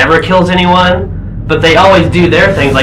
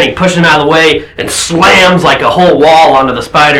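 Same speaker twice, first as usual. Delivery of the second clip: usual speaking voice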